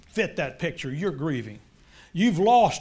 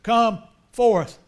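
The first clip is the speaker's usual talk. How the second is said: much louder, called out to the tomb; Jesus calling Lazarus out of the grave; commanding call; 4 semitones higher, 10 dB louder, slower than usual. Acted. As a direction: summon the dead man with full force. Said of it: "went to his tomb and said".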